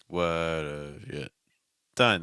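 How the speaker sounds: slow, DONE pops up in pitch; "What have you done?" slowly, with "done" popping off and pitched; slow model phrase. Shown as a demonstration. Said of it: "done's gonna pop off and pitch".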